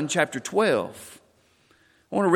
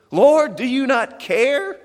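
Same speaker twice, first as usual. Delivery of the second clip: slow, high, loud reproach; exasperated accusation; 8 semitones higher, 6 dB louder, slower than usual. Acted. Martha to Jesus, a slow exasperated reproach. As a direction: wring it out of him, hurt.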